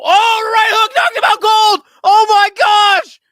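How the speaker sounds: very high, full-volume excited yell; knockout shout. Real, not acted. Commentator seeing a knockout land, wildly excited shout. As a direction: scream the knockout call.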